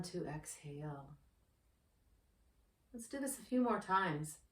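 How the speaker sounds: soft, unhurried meditation guide voice; guided meditation. Acted. Yoga teacher guides anxious viewers through breathing, in a soft meditation voice. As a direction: ease the listener into calm breathing.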